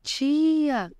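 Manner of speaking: high-pitched mock call of a small child; niece calling out. Small niece calling her aunt from the door, high mock call. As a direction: call out like the little niece from afar. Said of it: "lá no fundo gritando".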